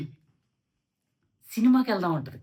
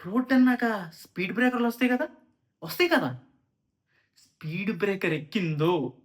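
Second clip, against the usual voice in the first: ordinary talk, nothing marked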